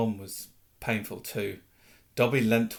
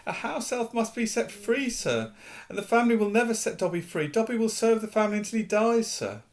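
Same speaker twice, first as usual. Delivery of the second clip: high, sad elf voice; resigned house elf; 9 semitones higher than usual. Acted. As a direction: accept your fate. Dobby resigned.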